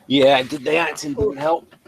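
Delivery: normal talking voice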